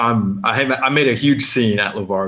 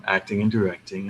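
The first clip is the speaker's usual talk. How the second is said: subdued answer, trailing softer; unenthused star; 3 semitones lower, 6 dB quieter than usual. Retold or imitated. Mimicking a star who gives a fan nothing, unenthused. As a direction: mimic someone giving the bare minimum, quietly. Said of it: "he said everything like that".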